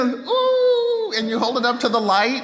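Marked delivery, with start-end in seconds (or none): 0.0-1.1 high, delighted mock ooh; mock amazed ooh. Pastor mimicking kids awed by a kaleidoscope, high ooh. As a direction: coo like a thrilled kid at a toy. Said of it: "you get a".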